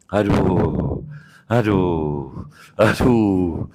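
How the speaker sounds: low, drawn-out "aduh" groans; demonstrated moaning. Shown as a demonstration. Preacher showing listeners moaning during prayer, drawn-out low groan. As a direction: moan each aduh long and low, trailing off. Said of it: "merintih itu bakal keluar huruf".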